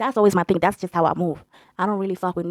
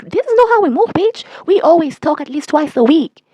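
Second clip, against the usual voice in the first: higher-pitched, worked-up retelling of an argument; mock indignant quote; 7 semitones higher, 3 dB louder than usual. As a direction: protest it in a raised, put-on voice. Retelling his side of argument.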